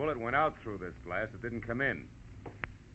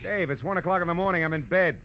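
groggy protest; 10 semitones higher, 5 dB louder than usual. Acted. Woken detective protests the hour.

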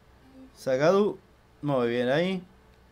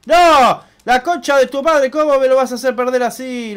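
sudden high yell of rage, louder at first; real furious shout; 8 semitones higher, 8 dB louder than usual. Real, not acted. Gamer yelling at the game when the enemy catches him, furious. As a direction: explode as the enemy catches you.

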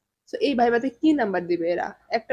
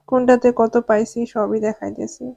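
ordinary talk

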